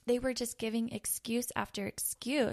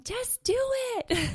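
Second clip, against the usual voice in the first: much higher and louder, a booming order; God's loud command; 13 semitones higher, 7 dB louder than usual. Acted. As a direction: call it out big, God urging you forward. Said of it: "And God saying".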